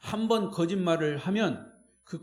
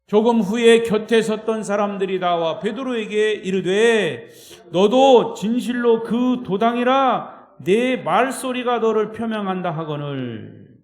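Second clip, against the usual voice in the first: scripture reading; 2 semitones higher, 9 dB louder, slower than usual. Acted. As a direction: lead the congregation through the verse at full voice. Reads scripture aloud with congregation. (큰 소리로 성경 봉독)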